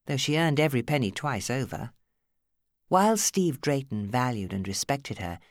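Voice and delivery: ordinary delivery, nothing marked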